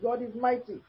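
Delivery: usual speaking voice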